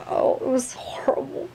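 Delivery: whispered, high and dismayed; aghast whisper; horrified whisper. Acted. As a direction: hush it like a shameful secret. Describing a bad haircut.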